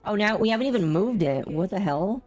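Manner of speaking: ordinary talk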